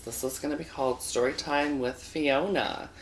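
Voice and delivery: ordinary talk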